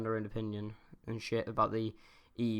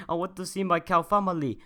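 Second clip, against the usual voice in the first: lightly put-on Indian accent, higher pitched; 5 semitones higher, 4 dB louder than usual. Vlogger joking about visiting family in Ireland, in an Indian accent. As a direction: attempt an Indian accent, a bit louder. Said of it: "did a fucking Indian accent".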